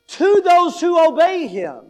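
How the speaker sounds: THOSE WHO OBEY HIM punched out high and loud; emphatic stress. Shown as a demonstration. Preacher stresses who is saved in the verse, loud and emphatic. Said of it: "see how he's emphasizing".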